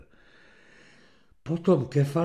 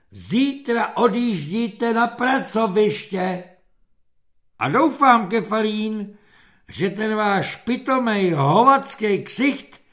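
slow, high, emphatic officer's voice; exasperated captain, drawn-out and building; angry dismissal; 7 semitones higher, 5 dB louder, slower than usual. A narrator's reading. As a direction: spell out the banishment, building to loud. Captain angrily dismisses the soldier.

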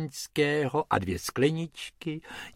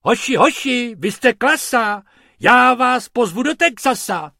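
loud, high cheering tourist; calling out; 10 semitones higher, 8 dB louder than usual. Acted. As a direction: shout your delight at the boys. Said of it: "pan Pumpernagel volal".

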